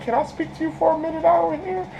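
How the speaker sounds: high, soft, falsely sweet voice; sweet sinister girl. Retold or imitated. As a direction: lure her away sweetly, pitched high.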